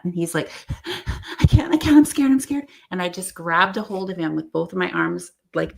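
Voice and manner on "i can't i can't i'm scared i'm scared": higher, louder frightened pleading; imitating a panicked man; scared. Man terrified retold.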